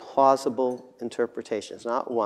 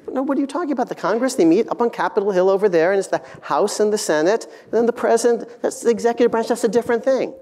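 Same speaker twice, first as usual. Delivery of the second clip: much higher, disbelieving civics explanation; incredulous passerby; 9 semitones higher, 4 dB louder, faster than usual. Acted. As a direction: answer as if the question were absurd, pitched high. Imagined passerby's incredulous answer.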